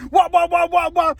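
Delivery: loud, high "wah" repeated rapidly; imitated bar rattling. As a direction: mimic the racket of shaking prison bars. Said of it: "He starts shaking the bars".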